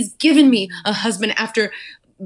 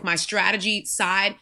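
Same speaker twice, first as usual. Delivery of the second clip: usual speaking voice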